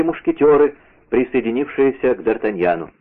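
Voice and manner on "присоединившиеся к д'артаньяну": ordinary delivery, nothing marked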